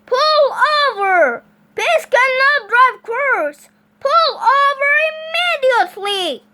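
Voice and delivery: bullhorn shout. A narrator's reading. Officer orders the pig to stop, high loud shout. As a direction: bellow each order at a runaway driver. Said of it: "shouted into his bullhorn".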